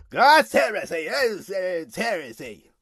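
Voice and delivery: exaggerated drawn-out mockery in a put-on voice; mocking imitation. Imitating those mocking this idea, exaggerated mocking voice. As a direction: mimic scoffers, stretching out the words. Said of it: "they're mocking this and".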